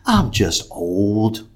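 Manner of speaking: nice again. Retold or imitated. Old man admits his aches to stranger, mild. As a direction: let it sag gently and drag out. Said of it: "then he's all nice again".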